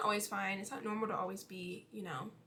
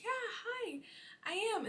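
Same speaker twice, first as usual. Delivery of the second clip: lightly voiced nervous guy, higher; imitating neighbour; 11 semitones higher than usual. Neighbour answering the door, high nervous imitation. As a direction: play the flustered neighbour. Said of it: "And he was like".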